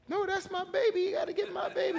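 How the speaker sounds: high, loud mock-hysterical mother; hammed-up crying mom in high falsetto; imitating distraught mother. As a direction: wail it like a frantic, protective mother. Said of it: "she was crying, and she grabbed me".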